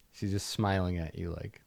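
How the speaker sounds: usual speaking voice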